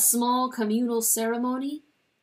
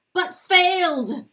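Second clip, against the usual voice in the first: chilly clarity; 9 semitones higher, 10 dB louder, slower than usual. Acted. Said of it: "with a chilly clarity".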